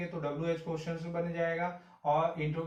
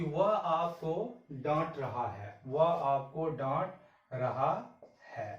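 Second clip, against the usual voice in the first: slow dictation; much slower than usual. Shown as a demonstration. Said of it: "इस चीज को गौर से देखिए".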